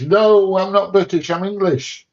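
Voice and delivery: high, loud indignant protest; emphatic correction. Real, not acted. Insisting to the chat that he is English, loud and high. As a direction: set the record straight with force.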